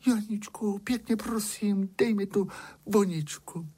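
high, sustained princess plea; storyteller's high-voiced pleading princess. A narrator's reading. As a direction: beg nicely in a high princess voice. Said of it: "Vyběhla na most a prosila".

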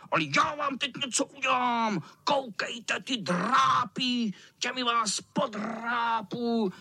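high, menacing cat squeal; hammed-up wicked cat; threatening tomcat. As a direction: start quick, then stretch the threats.

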